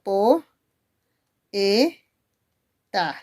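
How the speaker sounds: "po, e, ta" in three isolated syllables; syllable separation. Shown as a demonstration. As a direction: pause after "po" and after "e". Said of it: "te hacían separar la o de la e". ("po, e, ta" separando cada sílaba con pausa)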